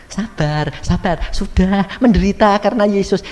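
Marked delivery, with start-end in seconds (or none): none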